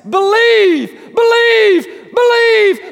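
loud, high ringing believe; God's voice calling out, loud and high; shouted call. Acted. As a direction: shout it down from heaven. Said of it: "like he is shouting from the heavens".

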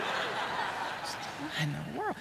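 laughing disbelief. Real, not acted. Mock dismay at Alabama shirt.

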